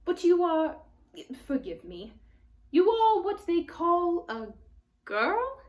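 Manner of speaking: a wide-eyed, curious faun asking; surprised faun. Acted. Faun voice, high.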